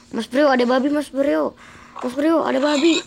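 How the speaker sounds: high, loud excited call. Acted. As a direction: call out to him, all excited. Spots pig, calls friend.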